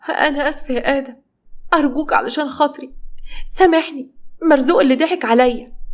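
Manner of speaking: high, pitiful begging voice; pleading apology. Acted. Unfaithful wife begging husband to forgive her, high pleading.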